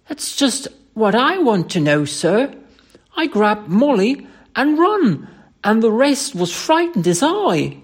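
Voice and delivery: high, loud, bewildered Cockney; Cockney dialect. Acted. Thomas puzzles over why he fled, agitated loud Cockney. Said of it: "Thomas' Cockney dialect".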